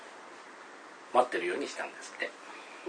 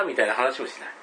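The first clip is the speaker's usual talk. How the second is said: ordinary talk, nothing marked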